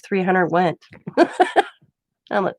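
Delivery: ordinary talk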